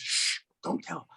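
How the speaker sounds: whispered shush; hushed secret. Acted. Old man confiding his lie to his friend, hushed whisper. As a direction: drop to a conspiratorial whisper.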